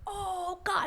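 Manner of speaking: very high, overdone astonishment; mock outrageous reaction. Everybody hearing of the kiss, high mock astonishment. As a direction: send the pitch soaring in mock shock. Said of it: "these, like, these outrageous reactions".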